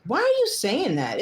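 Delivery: exasperated retort. Retold or imitated. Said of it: "And I was like".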